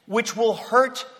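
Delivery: ordinary talk, nothing marked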